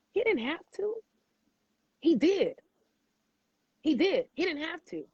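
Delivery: voice rising with emotion. Real, not acted. Emotional praise, pitch jumps high.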